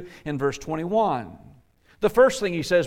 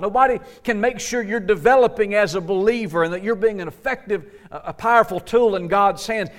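higher, driving exhortation; fervent preaching; 4 semitones higher than usual. Acted. Preacher urging believers to fight for themselves, fervent. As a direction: urge them hard that nobody else can. Preacher urges self-responsibility.

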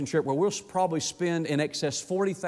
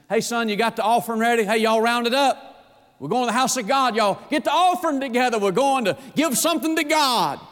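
rally the household to church; eager calling out; 6 semitones higher than usual. Acted. Father calling his family to bring the offering, eager and raised.

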